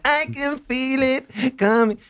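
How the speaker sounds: high, slow, lisping Tyson imitation; squeaky high voice with a lisp, drawn out; Mike Tyson voice. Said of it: "with his little high-pitched voice and his lisp".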